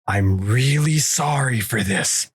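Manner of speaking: slow, drawn-out apology; mock apology. Buddy about to hit the spider, slow mock apology. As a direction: apologise slowly before the blow. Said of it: "And my buddy John's just goes".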